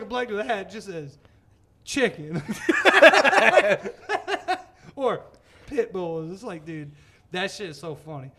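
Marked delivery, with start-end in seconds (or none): 2.4-4.9 high rising laugh burst; hearty cackle; loud laughing. Real, not acted. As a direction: crack up loudly. Laughs at own joke.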